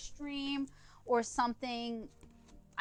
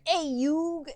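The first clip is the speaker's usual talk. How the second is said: lightly voiced brash cartoon accent, higher and louder; Joey Wheeler; 6 semitones higher, 7 dB louder than usual. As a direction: toss out a loud, pitched-up bit of a character impression. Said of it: "I can do the Joey Wheeler impression".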